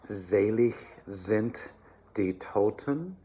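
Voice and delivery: slow, careful sung-style German; German diction demo. Shown as a demonstration. Choir coach modelling the text, slow careful German. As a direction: model the phrase slowly for the choir. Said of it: "we start with the first phrase".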